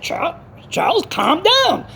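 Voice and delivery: high-pitched retold plea to calm down; imitated pacifying caller. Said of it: "He said".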